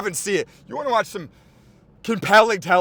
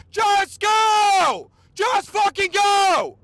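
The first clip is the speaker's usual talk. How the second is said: shouting; much slower than usual. Retold or imitated. Urging actors to just act.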